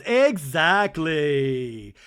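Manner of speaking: very slow, loud mock agreement; drawn-out agreement. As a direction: stretch every syllable in emphatic agreement. Mimicking Lisa's delighted agreement.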